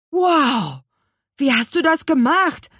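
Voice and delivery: put-on high, loud wowed boy; amazed cry. A narrator's reading. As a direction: exclaim in open amazement. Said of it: "rief Louis".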